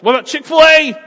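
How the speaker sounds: high, loud, squealing voice; excited shout. Acted. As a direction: shriek it out in a comic high voice. Couple skit, shouted suggestion.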